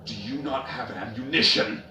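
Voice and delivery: high, loud demanding question; shouted question. A narrator's reading. Darkseer demanding why his marines stopped firing, loud shout. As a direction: yell it at the stalled squad. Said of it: "Darkseer shouted".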